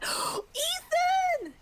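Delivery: high gasping call of the name; excited call. Real, not acted. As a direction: call out thrilled.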